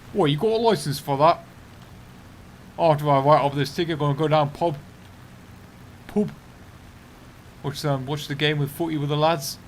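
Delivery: loud, hammed-up British working-bloke accent; put-on British accent. Streamer mimicking a licence ticketer, broad British accent. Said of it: "I'm not very good at accents".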